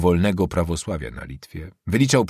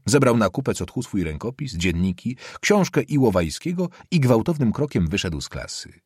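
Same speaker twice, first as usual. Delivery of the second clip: plain reading voice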